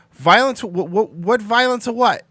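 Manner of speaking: high, loud disbelief; incredulous outburst. Real, not acted. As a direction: sputter in outraged surprise. Indignant about a video strike.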